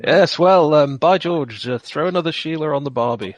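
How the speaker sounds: mock Aussie voice, played for laughs; Australian accent. Party jokes in Australian accents.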